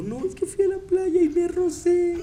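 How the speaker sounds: high, slow whimpering, imitating a friend crying; mock crying. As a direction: whine it out like a teenager in tears. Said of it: "te lo juro que empezó a llorar". (imitando un llanto agudo y lento)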